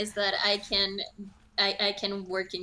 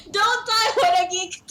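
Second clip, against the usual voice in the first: much higher, louder cry; real urgent shout; shouted plea; 9 semitones higher, 6 dB louder than usual. Real, not acted. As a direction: yell at a teammate not to die. Urging teammate in fight.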